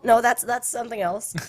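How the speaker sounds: normal talking voice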